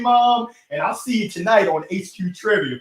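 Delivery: ordinary talk, nothing marked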